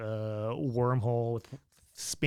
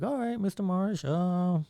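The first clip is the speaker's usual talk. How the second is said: lightly imitated doctor, pitched higher; subdued hippie doctor; 6 semitones higher than usual. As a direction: break it gently as a deflated doctor. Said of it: "he doesn't have his little pep to his step anymore".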